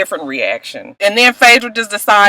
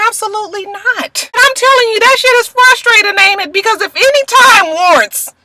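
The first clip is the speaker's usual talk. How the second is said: frustrated high rant; 12 semitones higher than usual. Real, not acted.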